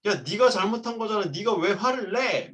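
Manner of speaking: 아이에게 짜증 섞인 목소리로 따지듯이; imitating angry scolding. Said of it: "같이 화를 내는 경우들이". (망가진 레고에 우는 아이를 꾸짖는 아빠, 화난 흉내)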